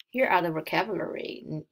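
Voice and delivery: usual speaking voice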